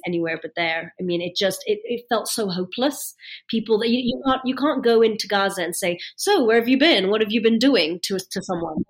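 usual speaking voice

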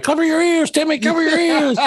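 high-pitched hammed-up parent telling kids to cover ears; mock frantic parent. Dad shielding kids from swearing, mock panic.

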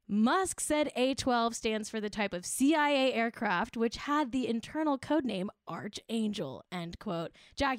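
ordinary talk, nothing marked

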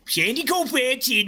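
Hubie Halloween voice. Pretend. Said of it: "I also talk like Hubie Halloween".

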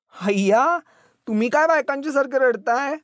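high-pitched, playful scolding; amused teasing. A narrator's reading. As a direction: tease with laughter just breaking through tears. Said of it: "रडव्या चेहऱ्यातून हसूच फुटलं".

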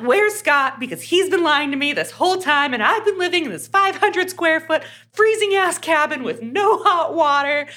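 hammed-up indignant tirade, pitched high; amped. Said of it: "'cause she's amped".

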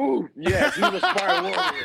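high, loud, through big laughter; laughing agreement. Real, not acted. Player agrees his teammate looks like a movie fighter, laughing loudly. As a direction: crack up while agreeing, voice shooting up.

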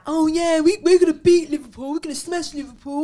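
lightly mimicked boastful fans; mock their cocky chant of certainty; mocking overconfident fans. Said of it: "They just think that".

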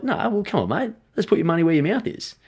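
high-pitched, coaxing retold dare; mock-pleading push to take the bet; goading challenge. Retells goading client into the bet.

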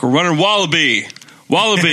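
lightly acted youth coach; coach calling play. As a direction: call out the play to little kids. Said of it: "I'll tell them that we're running".

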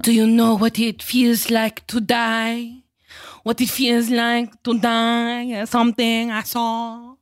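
slow, drawn-out imitation of a movie accent; slow monologue in a put-on accent; Wanda's accent. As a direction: do a hammed-up foreign movie accent, dragging it out. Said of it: "this monologue in this accent".

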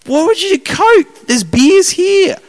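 high, slow, baffled innocent voice; naive high squeak. Retold or imitated. Retelling his own naive reply at a comedian's party, high and baffled. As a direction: play the clueless guy who only wants beer.

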